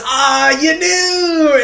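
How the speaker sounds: high, slow hammed-up delight; mock-amazed character voice; mock surprise. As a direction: draw out a fake surprised gasp of a line. Shoichi voiced in retelling.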